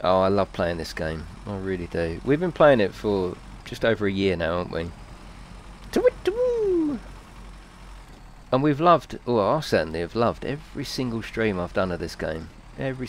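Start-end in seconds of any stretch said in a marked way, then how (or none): 5.9-7.0 high falsetto do-it-do; bird call. Pretend. Streamer tossing a high chirp into the chat.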